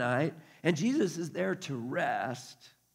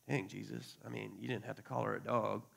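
low, soft aside of discomfort; hushed mock dismay; mild retold reproach; 6 semitones lower, 10 dB quieter than usual. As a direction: gently object under your breath. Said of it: "We're like".

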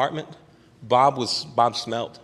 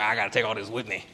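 smoker's rasp; 2 semitones lower, 6 dB quieter than usual. Retold or imitated. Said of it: "He had, like, the smoker's voice".

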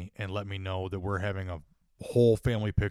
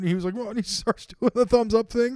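laughing; 12 semitones higher, 6 dB louder than usual. Real, not acted. Laughing through the story.

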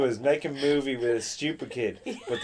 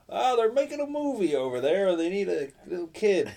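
high, eager retelling of a rumour; excited schoolkid gossip; 6 semitones higher than usual. Retold or imitated. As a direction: spread exciting news like a schoolkid. Excited pupils retold in story.

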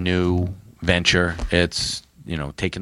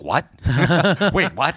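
high-pitched, incredulous double take; baffled disbelief; 8 semitones higher than usual. Retold or imitated. Aphra hearing unexpected praise from the Emperor, baffled. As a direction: act out her startled confusion. Acts out her stunned reaction.

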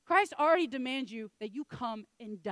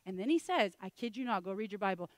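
normal talking voice

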